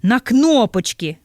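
slow, loud, bitten-off answer; on the edge of hysteria. Acted. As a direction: spit it out near hysteria. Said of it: "на межі істерики". (на межі істерики, повільно й голосно)

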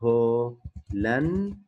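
هولندي very slowly, in long separate syllables; syllable-by-syllable demonstration. Shown as a demonstration. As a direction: pause between the parts of هولندي. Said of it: "هولندا بتصير".

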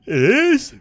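high, loud mock surprise; surprised. Real, not acted. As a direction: squeak it in disbelief. Mock disbelief.

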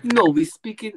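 a quick, poor French accent impression; mock French accent. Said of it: "my poor accent".

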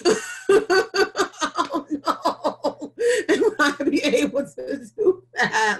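high, loud laughing disbelief. Real, not acted. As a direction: laugh it off in awe. Laughing at the thought of seven losses.

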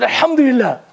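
a touch of relief and gladness; with happiness. Retold or imitated. Shaykh told his shop alone survived, lightly glad. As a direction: let a little joy lift it. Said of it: "But with happiness".

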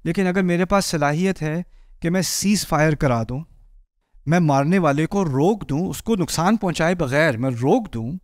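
ordinary delivery

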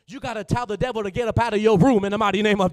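very loud pulpit command; full-force preaching. Real, not acted. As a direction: shout it out with a preacher's authority.

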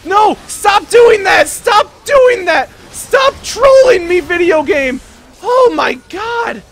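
very high, loud, drawn-out yells; exasperated screaming. Real, not acted. Player raging at a trolling video game, exasperated screaming. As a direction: scream in outraged despair at the game.

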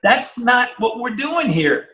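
high, slow, stunned hissing at a friend; what on earth are you doing, drawn out; high shocked scold to a friend; shocked disbelief. Retold or imitated. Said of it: "I look at Harley like".

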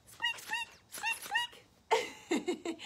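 very high soft squeaky toy noises; duck squeaks. Acted. Squeaking the rubber duck for the children, very high. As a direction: squeeze out tiny squeaks.

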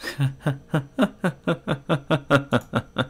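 long mad cackle; acted maniacal laughter; bizarre laugh. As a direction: burst into a drawn-out, crazed laugh. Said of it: "She burst out into a bizarre laugh".

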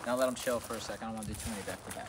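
ordinary talk

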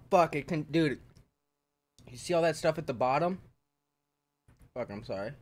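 ordinary talk, nothing marked

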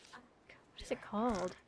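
slow whispered question; whisper to self. Real, not acted. Trying to remember the movie title, whispering. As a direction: breathe it out, slowly searching.

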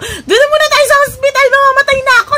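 very high, loud plea through laughter; desperate shout. Retold or imitated. To the driver, a very high loud plea. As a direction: scream that you're dying. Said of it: "Sabi ko".

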